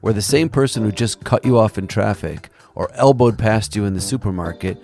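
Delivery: a documentary host's heartfelt closing read; podcast narration. Acted. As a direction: narrate it warmly to the audience. Podcast narration over music.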